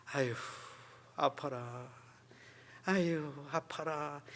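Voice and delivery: soft, slow mock whimper of pain; a hushed slow show of holding in the hurt; mock quiet moaning. Shown as a demonstration. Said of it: "이렇게 하는 사람이 있다고".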